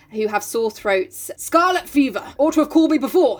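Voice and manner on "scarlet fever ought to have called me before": higher, louder scolding exclamation; cross. A narrator's reading. Doctor scolding the poor mother, crossly. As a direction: tell them off briskly and sharply. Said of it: "he said crossly".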